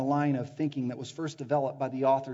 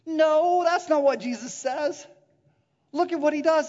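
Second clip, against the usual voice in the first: loud, high-pitched preaching burst; excited exclamation; 12 semitones higher, 9 dB louder than usual. Real, not acted. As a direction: correct them with loud excitement. Preacher exclaims against mistaken answer.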